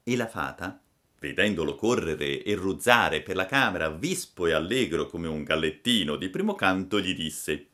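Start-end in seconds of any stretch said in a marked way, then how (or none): none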